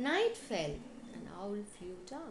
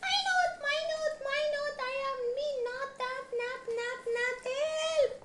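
very high, loud cry for help; 7 dB louder than usual. A narrator's reading. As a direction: yelp in a high, panicked elephant voice. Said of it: "cried the elephant".

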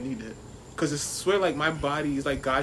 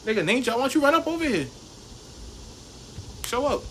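mock call-out; 9 semitones higher than usual. Taunting rivals to show up, a mock call-out. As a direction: dare them to come over. Calling out a challenger.

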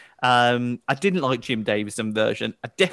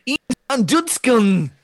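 put-on Dutch accent, higher and louder; 10 semitones higher, 6 dB louder than usual. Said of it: "That was better than my Dutch accent".

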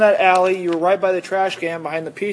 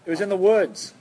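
Canadian accent. Real, not acted. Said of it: "Was that a Canadian accent?".

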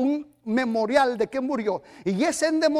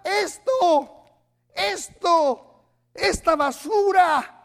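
disgusted shout; 5 semitones higher, much slower than usual. Acted. Pastor holding up the filth before the church, disgusted shout. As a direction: spit out the filth.

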